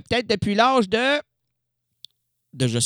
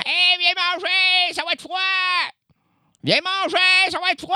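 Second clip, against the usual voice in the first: put-on calling the kids; 13 semitones higher than usual. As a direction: holler across the yard that supper is ready. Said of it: "comme si j'appelais mes enfants".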